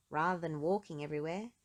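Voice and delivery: plain reading voice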